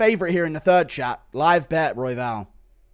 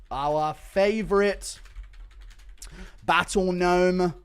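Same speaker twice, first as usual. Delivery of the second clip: slow, soft announcer send-off; dramatic tribute; 7 semitones lower, 4 dB quieter, much slower than usual. Real, not acted. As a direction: lay it on like a tribute.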